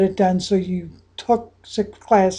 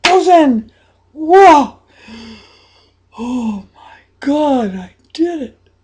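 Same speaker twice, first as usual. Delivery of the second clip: excited disbelief; 2 semitones higher, 5 dB louder, slower than usual. Acted. Coach acting out the winning shot, excited disbelief. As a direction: let the joy burst out.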